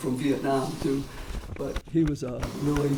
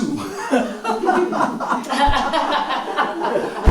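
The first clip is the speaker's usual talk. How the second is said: long, high burst of real laughter; a real, delighted laugh at recognizing a friend; 11 semitones higher, 4 dB louder than usual. Real, not acted. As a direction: laugh out loud in happy surprise.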